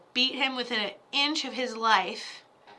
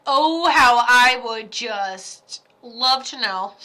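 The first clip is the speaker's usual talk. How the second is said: slow, loud theatrical sigh of longing; mock swooning; 9 dB louder, much slower than usual. Psychic hamming up her longing to know, slow and loud. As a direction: stretch it out like a melodrama. Dramatic wistful line.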